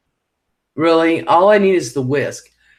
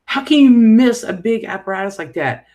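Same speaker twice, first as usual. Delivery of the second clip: retold annoyed rant; 8 semitones higher, 4 dB louder than usual. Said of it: "I was like".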